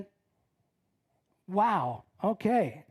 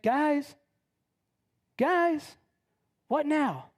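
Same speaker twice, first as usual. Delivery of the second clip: higher-pitched lost plea; forlorn calling; 5 semitones higher, much slower than usual. Acted. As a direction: call after friends who have left you. Abandoned Billy calls after the crowd.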